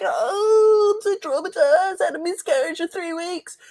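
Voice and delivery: high, whimpering mock sob; mock traumatized. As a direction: wail it like a melodramatic victim. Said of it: "people are like".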